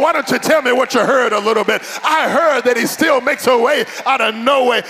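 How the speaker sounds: ordinary talk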